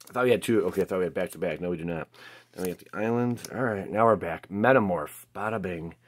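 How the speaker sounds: normal talking voice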